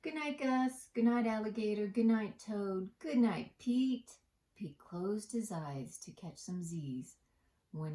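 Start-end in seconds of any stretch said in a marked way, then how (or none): 0.0-4.2 higher, louder goodnight chant; singsong goodnight. Acted. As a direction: call out goodnights cheerfully. Gang says goodnight loudly.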